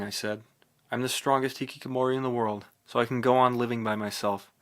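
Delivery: ordinary delivery, nothing marked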